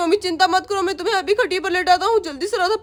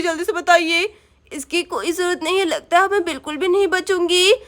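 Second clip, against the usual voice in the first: normal talking voice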